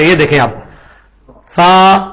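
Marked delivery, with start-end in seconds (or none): 1.6-2.0 "سا" with the tongue touching the upper teeth, no hiss; Arabic tha sound. Shown as a demonstration. Teacher shows students the tongue between the teeth, no hiss. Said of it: "زبان تھوڑی سی باہر نکالیں گے".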